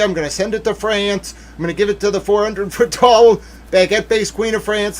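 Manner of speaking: high, loud run of escalating boasts; imitated gleeful scheming. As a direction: act out her plan, piling it on excitedly. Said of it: "She was like".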